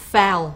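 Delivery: loud, isolated "foul" as one syllable; careful pronunciation demo. Shown as a demonstration. Teacher repeating the tricky word for students, careful "foul". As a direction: repeat "foul" distinctly for learners to copy. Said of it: "pay attention to the pronunciation of this word".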